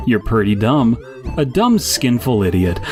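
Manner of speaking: usual speaking voice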